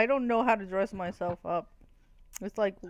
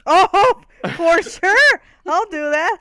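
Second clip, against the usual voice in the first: loud, high, laughing enthusiasm; mock eagerness; 9 semitones higher, 11 dB louder than usual. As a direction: jump at the offer, much louder. Jokingly volunteers as a lawyer.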